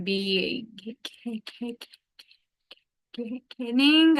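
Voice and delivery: stuttering blocks on the k sound. Real, not acted. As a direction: repeat the k sound, stuck stutter.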